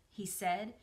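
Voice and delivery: ordinary delivery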